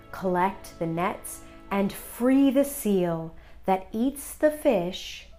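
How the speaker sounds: ordinary delivery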